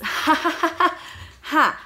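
high, loud fake laughing ha ha; sarcastic forced ha-ha, loud and high; mock laughter.

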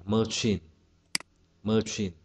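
normal talking voice

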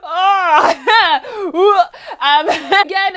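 high, loud laughing outburst. Real, not acted. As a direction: crack up mid-sentence. Bursting into laughter mid-review.

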